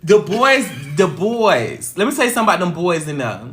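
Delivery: ordinary talk, nothing marked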